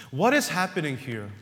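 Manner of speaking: ordinary talk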